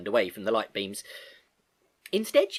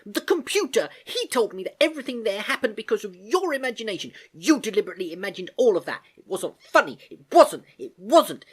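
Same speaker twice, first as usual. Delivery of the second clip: higher and louder, indignant; upset insistence; 10 semitones higher, 5 dB louder than usual. Acted. Girl accusing her uncle, indignant. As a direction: insist through hurt.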